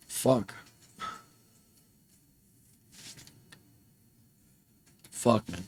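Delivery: soft, breaking-down expletive; tearful. Real, not acted. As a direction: swear quietly while holding back tears. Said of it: "I don't know I'm crying".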